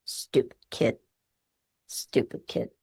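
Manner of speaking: low, muttered judging grandpa; grumpy grandpa. Acted. Therapist voicing the judging grandpa part, low and scornful. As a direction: grumble it like a scornful old man. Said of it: "grandpa sitting there going".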